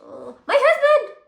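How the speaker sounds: very high, loud squeal; startled exclamation. Real, not acted. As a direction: cry out in sudden discovery. Finds husband already opened it.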